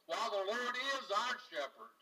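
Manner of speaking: ordinary delivery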